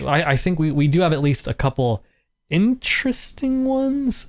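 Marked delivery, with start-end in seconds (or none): 2.5-4.3 teasing sing-song. Real, not acted. Teasing next episodes.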